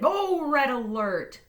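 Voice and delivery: high, loud, slow alarm call; mock alarm. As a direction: sound the alarm playfully. Warning to flip the inequality.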